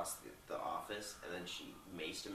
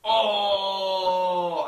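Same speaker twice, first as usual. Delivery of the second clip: higher, much louder oh; sudden realisation; 10 semitones higher, 20 dB louder than usual. Real, not acted. Suddenly remembering the fight scene, a loud surprised oh. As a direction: catch yourself remembering something. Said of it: "I forgot about the Anchorman fight".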